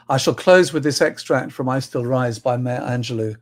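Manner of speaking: ordinary delivery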